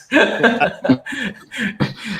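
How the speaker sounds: a short burst of high laughter; laughing. Real, not acted. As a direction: laugh it off, embarrassed. Laughs at own tired look.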